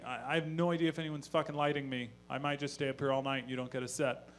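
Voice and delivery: ordinary delivery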